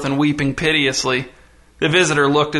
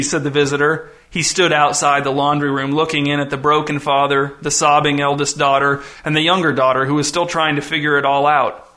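plain reading voice

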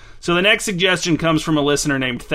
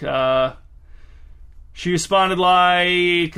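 very slow, drawn-out words; stalling; much slower than usual. Acted. Host stalls before inventing Teresa's reply, words dragged out. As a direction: stretch the words out while hunting for an ending.

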